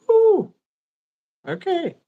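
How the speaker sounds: high, sudden excited whoop; excited whoo. Retold or imitated. Bracing for the coming heat.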